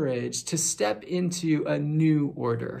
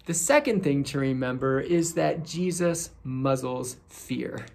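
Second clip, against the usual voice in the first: normal talking voice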